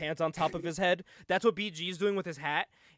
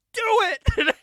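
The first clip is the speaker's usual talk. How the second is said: high, loud urging shout; retold crowd urging him to swing the chair; crowd yelling; 12 semitones higher, 7 dB louder than usual. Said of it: "everyone's like".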